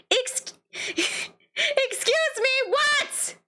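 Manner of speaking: very high, drawn-out incredulous squeal; hammed-up disbelief. Player peaking in a looped exchange, hammed-up disbelief. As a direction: squeak it out slowly in mock outrage. Peak of the repeated question.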